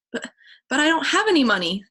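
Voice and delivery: nervous protest; scared. Acted. Chet scared on threatening call.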